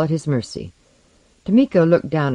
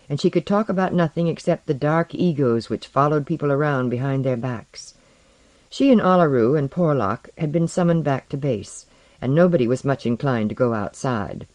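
plain reading voice

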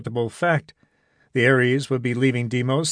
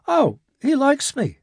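breezy and sure, pitched higher; easy self-assurance; 12 semitones higher than usual. A narrator's reading. Jimmy assures Irene that Gibson likes him, breezily. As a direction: shrug it off, totally confident. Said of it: "with easy self-assurance".

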